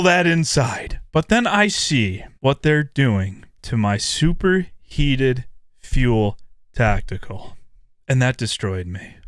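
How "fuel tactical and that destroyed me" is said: hammed-up mourning for a nerf; mock heartbreak. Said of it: "It was just sadness".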